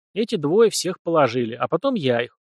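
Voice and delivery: ordinary delivery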